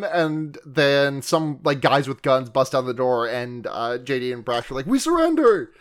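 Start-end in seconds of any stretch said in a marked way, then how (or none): 4.9-5.7 high, meek "we surrender"; high mock surrender. Two friends caught by gunmen, high meek surrender. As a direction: squeak it out like giving up instantly.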